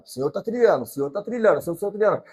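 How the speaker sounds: ordinary talk, nothing marked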